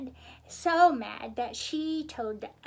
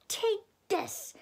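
high, mild storybook yell; 7 semitones higher than usual. A narrator's reading. Miss Piggy about to chop Kermit, mild yell. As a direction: pitch it up as a threat. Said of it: "yelled Miss Piggy".

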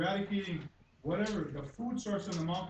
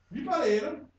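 louder and higher, furious at the officials; real angry shouting; 3 semitones higher, 6 dB louder than usual. Real, not acted. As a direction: shout it at them, fed up. Angry speaker confronts officials.

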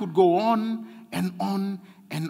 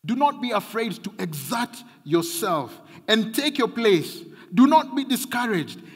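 loud, higher preacher's proclamation; preaching; 7 semitones higher, 8 dB louder than usual. Acted. Pastor proclaiming God's command to the church, loud preaching. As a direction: thunder the promise to the people.

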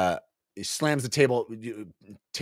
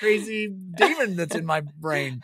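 put-on childish boasting voice; 8 semitones higher than usual. Voicing the demon-possessed character.

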